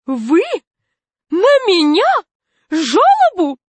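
loud, high, rising incredulity; each word a separate stunned question; utter astonishment. A narrator's reading. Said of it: "так удивилась, что чуть в обморок не упала".

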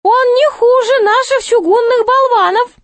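very high, squeaky clown voice; objecting jester. A narrator's reading. Jester mocking the king's knights, high-pitched objection. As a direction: pipe up in protest, far above natural pitch. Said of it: "возражал шут".

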